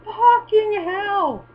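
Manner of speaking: drawn-out, high, astonished curse; amazed swear. Real, not acted. Watching the pigeon's display flight, slow amazed swear. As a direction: stretch it out in real awe.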